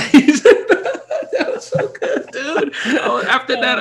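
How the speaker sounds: high, breathy laughter through words; laughing. Real, not acted. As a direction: crack up mid-sentence. Cracks up at the memory.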